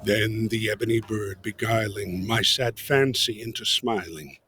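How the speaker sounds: ordinary delivery, nothing marked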